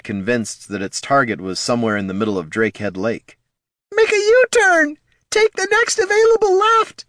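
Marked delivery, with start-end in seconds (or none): 3.9-7.0 high, loud mechanical squeal; a shrill gadget barking orders; squeaky robot voice. Acted. Gazer gives directions.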